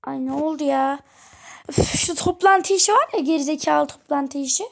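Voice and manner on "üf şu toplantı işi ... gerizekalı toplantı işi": irritated grumble. Pretend. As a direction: vent about the stupid meeting. Father complaining about meeting. (toplantıdan bıkmış baba, sinirli ve yüksek sesle)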